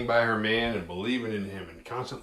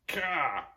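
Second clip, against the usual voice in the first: sharp higher grunt of effort; mock stab grunt; 7 semitones higher, 4 dB louder than usual. Mutant shoving machete into Decker, mock stab grunt. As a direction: spit out a short violent grunt.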